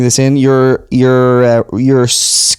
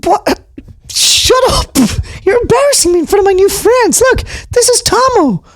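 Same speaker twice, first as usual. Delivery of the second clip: much higher squeak, embarrassed outburst; shrill mouse puppet telling bear to shut up; squeaky puppet snapping. Acted. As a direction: hush him up in front of friends. Tutter embarrassed.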